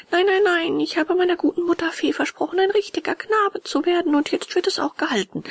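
put-on high, loud, insistent boy puppet; resolute puppet boy. A narrator's reading. Puppet refuses friend, promised his fairy mother, high resolute voice.

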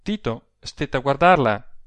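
ordinary delivery, nothing marked

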